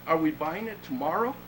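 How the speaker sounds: usual speaking voice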